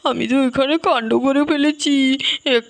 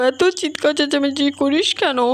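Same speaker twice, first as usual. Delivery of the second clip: ordinary delivery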